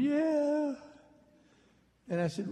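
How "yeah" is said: small, high, sheepish "yeah"; meek father. Retold or imitated. Son imitating his chastened father's meek high yes. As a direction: squeak it out like a cornered dad. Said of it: "And poor Dad going".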